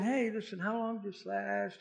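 very soft, hushed casual question; calm voice. Retold or imitated. Jesus walks in calming everyone down, very soft calm voice. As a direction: ask it quietly, easing everyone down. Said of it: "speaking in a very calm voice".

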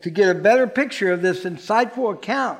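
ordinary delivery

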